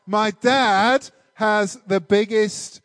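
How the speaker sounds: ordinary talk, nothing marked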